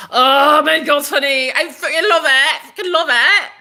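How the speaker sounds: high, loud gushing; excited. Real, not acted. Gushing to viewers about his skin, loud and high. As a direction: rave about him.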